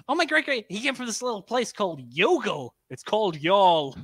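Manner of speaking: a lighter echo of the mock American tourist accent. Yank mispronouncing an Irish town, light American accent.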